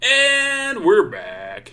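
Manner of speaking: slow, high, drawn-out host voice; show host return. Pretend. Returning from mock ad break.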